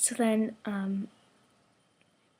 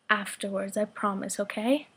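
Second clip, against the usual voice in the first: normal talking voice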